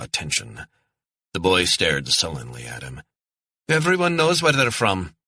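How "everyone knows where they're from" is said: put-on high Scottish kid voice, sulky retort; a defiant child talking back; sullen boy. Sullen boy answers the stranger back, Scottish.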